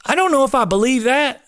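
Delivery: high, doubtful reply; skeptical retort. Retold or imitated. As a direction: raise the pitch in disbelief. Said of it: "And I'm like".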